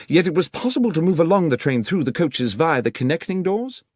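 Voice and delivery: lightly voiced keen detective; a hint of a brisk Holmes pressing facts; Holmes, crisp questioning. Holmes questions the inspector in the coach, brisk and keen.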